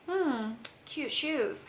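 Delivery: slow, drawn-out suspicious compliment; a slow, loaded hmm before the compliment; weird tone. Retold or imitated. The saleswoman eyeing her shoes, slow and suspicious. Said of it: "the way she said it was kinda weird".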